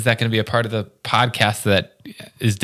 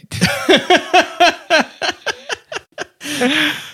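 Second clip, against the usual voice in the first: long rising burst of laughter; high squealing laugh. Real, not acted. Big laugh at the comic.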